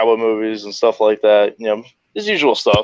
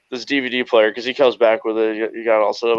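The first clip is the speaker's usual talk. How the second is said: ordinary talk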